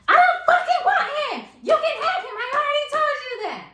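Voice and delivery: high-pitched, loud mock screech; yelling. Angry friend yelling in a sleeping house, screeching. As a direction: scream it at the top of your lungs. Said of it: "decides to yell at the top of her lungs".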